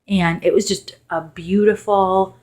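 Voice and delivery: ordinary delivery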